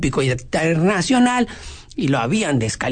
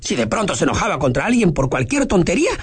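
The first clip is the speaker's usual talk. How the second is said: usual speaking voice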